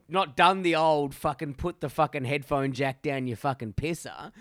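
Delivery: ordinary talk, nothing marked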